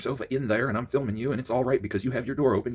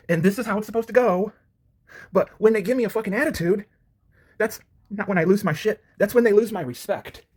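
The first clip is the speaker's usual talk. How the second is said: heated rant; 9 semitones higher, 6 dB louder than usual. Real, not acted. Heated rant about rude visitors.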